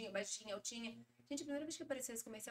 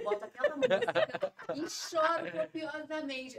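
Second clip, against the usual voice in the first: amiga dramática; 4 semitones higher, 9 dB louder, much slower than usual. Retold or imitated. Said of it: "amiga que fala isso".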